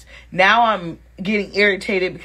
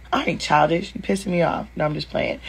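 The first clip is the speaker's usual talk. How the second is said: normal talking voice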